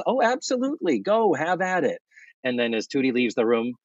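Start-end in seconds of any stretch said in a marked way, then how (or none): 0.0-2.0 higher, over-sweet mock approval; gush, falsely; fake support. Natalie cheering Tootie on falsely, higher and sweet. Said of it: "And then Natalie says".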